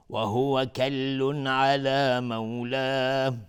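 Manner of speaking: slow, melodic, drawn-out chant; Quran recitation. Shown as a demonstration. Reciter chanting the whole verse slowly, melodic and devout. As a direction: let each phrase ring out, slow and devout.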